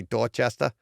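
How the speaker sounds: "Dorchester" said the Boston way, with dropped r's; Boston accent. Shown as a demonstration. Podcaster showing how locals say the town name, Boston accent.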